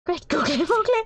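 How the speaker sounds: high, loud cartoonish alarm; shocked exclamation. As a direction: yelp in comic panic. Panicked exclamation at bomb.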